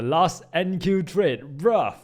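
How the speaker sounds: hyped about a small win; excited. Real, not acted. Excited about his trade.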